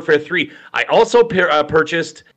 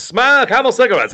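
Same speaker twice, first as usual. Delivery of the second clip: "Smoke" punched first, higher; chipper 1950s ad, stress on Smoke; 8 semitones higher, 3 dB louder than usual. Retold or imitated. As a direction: repeat with "Smoke" leading. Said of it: "cigarette commercials".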